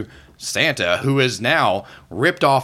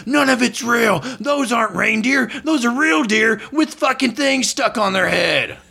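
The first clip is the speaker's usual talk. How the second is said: Santa shouting; 12 semitones higher than usual. Acted. Ranting Santa yelling at the crowd from behind. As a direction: bellow it from behind like an unhinged Santa. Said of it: "you hear".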